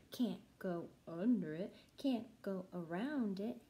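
ordinary talk

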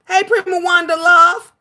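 usual speaking voice

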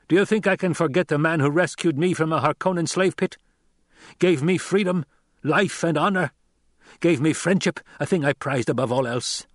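high, fervent outpouring; impassioned avenger. Acted. Loyal man recalling the master who rescued him, impassioned. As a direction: build the case with passion. Narrator voices Gurney's impassioned plea.

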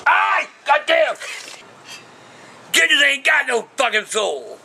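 sudden high yelp that carries into cursing; yelping as the toe gets popped; pained yell. Real, not acted. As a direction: cry out from the sharp pain. Yelps in pain from the toe.

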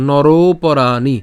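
নরোপরাণি with "ro" pulled long; stretched teacher's model of নরোপরাণি; drawn-out joined pronunciation. Shown as a demonstration. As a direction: drag the "ro" of নরোপরাণি straight into "poraani". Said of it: "একসাথে টেনে বলবো".

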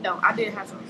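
talking with food in mouth; chewing while talking. Real, not acted. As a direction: mouth partly full. Seen 0: Bit chicken earlier; cheeks and pursed lips suggest chewing while talking.

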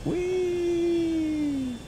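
very high sing-song whee; playful whee. Real, not acted. Flying back into race.

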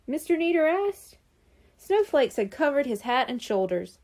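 plain reading voice

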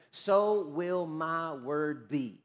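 very slow, each word set apart; slow solemn quote. Real, not acted. Preacher delivers God's promise from scripture, slow and solemn. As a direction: stretch every word out with weight. Solemn reading of the key verse.

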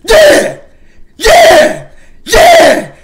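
very high and loud victory yells; hyped celebration shouts; triumphant yelling. Real, not acted. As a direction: scream in triumph.

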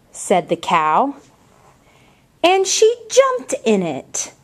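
slow, expressive storybook reading; picture book read aloud with lively character voices; reading to children. Acted. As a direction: read it like story time for little ones.

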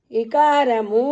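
ఇకారము with a short quick ఇ; short i sound. Shown as a demonstration. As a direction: clip the ఇ in ఇకారము. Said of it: "అనండమ్మా". (ఇకారము లో ఇ హ్రస్వంగా పలకండి)

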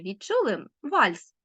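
ordinary talk

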